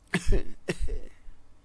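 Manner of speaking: put-on an airless old-man laugh that rattles out; a frail old ghost wheezing out a laugh; wheezy laugh. A narrator's reading. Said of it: "Fortran wheezed".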